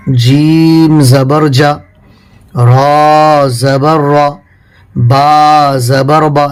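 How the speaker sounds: جیم زبر جا drawn out syllable by syllable; slow Qaida recitation of جیم زبر جا; very slow spelling. Shown as a demonstration. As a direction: spell each letter slowly for the pupil. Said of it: "یہاں پہ الگ الگ لکھا ہوا ہے".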